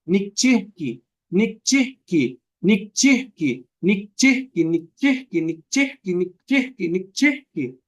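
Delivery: nikchihki with a cut at the h, a catch before the ki; teacher drills nikchihki slowly with a clipped break; repeated Nahuatl word. Shown as a demonstration. Teacher demonstrates the second form to compare, with a break in it. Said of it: "Y dice la otra forma".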